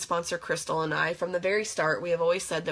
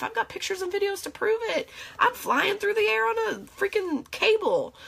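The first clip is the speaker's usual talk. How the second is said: excited gushing; 13 semitones higher than usual. Real, not acted. Gushing about her new life.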